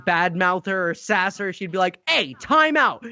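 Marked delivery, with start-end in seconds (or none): 2.1-3.1 higher mock-stern mother; scolding mother imitation. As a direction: lightly act a mother's reprimand. Mother scolding her sassy son.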